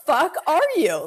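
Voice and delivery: high, sarcastic snap at the cop; sneering jab; mock angry retort. To the cop who stopped her, a mock angry jab. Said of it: "I'm like".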